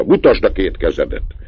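high, loud sharp orders; father checking his son's hands, loud and stern. A narrator's reading. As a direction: bark each command at the boy. Angry father examines son's hands.

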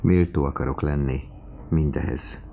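plain reading voice

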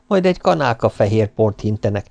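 ordinary delivery, nothing marked

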